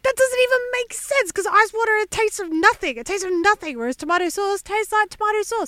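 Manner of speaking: high-pitched, exasperated objection; indignant protest. Acted. Guest rebutting the host's ice water jibe, indignant.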